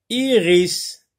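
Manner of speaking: "Iris" high, as two long syllables; word modelled for listening. Shown as a demonstration. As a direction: stretch "Iris" into "i... ris". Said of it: "Répète plusieurs fois ce mot".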